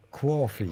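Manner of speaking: "coffee" attempted as "kwaw-fee"; aw sound. Shown as a demonstration. Learner practising "coffee" with an aw sound. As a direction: try an aw in "coffee". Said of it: "a aw sound like A U".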